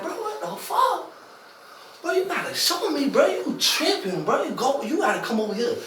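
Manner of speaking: high-pitched mock panic; alarmed protest. Inmate calling the officer over after eye jab, high alarmed protest. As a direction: squeal it in retold disbelief, pitch way up.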